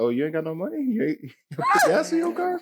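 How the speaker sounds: high, sneering mock of a girl asking about gas. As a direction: laugh through a high mocking question. Mocking a girl's question.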